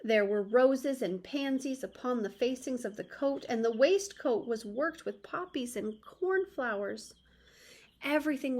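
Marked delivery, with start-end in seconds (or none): none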